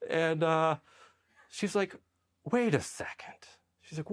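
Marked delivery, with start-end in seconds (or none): 2.5-3.5 whispered and higher; hushed, breathy realization. Retold or imitated. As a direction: whisper it as it dawns on her.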